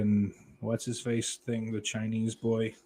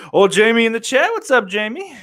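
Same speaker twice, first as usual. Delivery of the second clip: loud, high shout-out; high-pitched, hearty hello; excited greeting; 11 semitones higher, 10 dB louder than usual. Real, not acted. Hailing a chat viewer.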